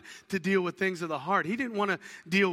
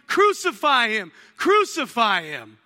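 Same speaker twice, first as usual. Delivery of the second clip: mock crowd yell; 8 dB louder than usual. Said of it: "these same people would be yelling".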